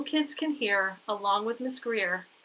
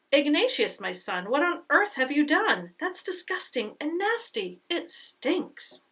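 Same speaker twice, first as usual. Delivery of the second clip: disgusted mother; 6 semitones higher than usual. A narrator's reading. Mother discovers dirty diapers.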